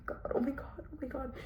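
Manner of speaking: imitated shocked whisper, very soft; horrified whisper. Student seeing the bite marks, imitated horrified whisper. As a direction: gasp it under the breath, mimicking horror.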